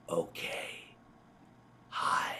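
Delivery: soft unvoiced ghostly whisper; eerie whisper. Retelling the final ghost words, whispered. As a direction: breathe it out like a faint presence. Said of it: "And then you hear".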